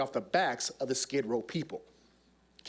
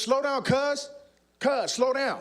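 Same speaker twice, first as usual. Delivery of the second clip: high, pitched-up mock holler; calling after someone; 9 semitones higher, 3 dB louder, slower than usual. Crips member chasing a runner, mock calling out. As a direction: call after a runner you are chasing.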